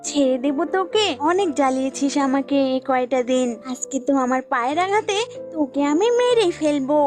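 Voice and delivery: lightly voiced goat, warning off; threatening goat. Goat warns snake off friendship, mildly threatening. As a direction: reject the offer with mild menace.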